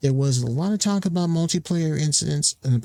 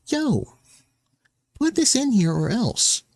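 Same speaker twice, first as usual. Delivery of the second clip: higher, mock-tough demand; mock pushy demand; 8 semitones higher, slower than usual. Mocking players demanding features from devs, mock pushy. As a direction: ham up a pushy player giving orders. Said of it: "trying to be like".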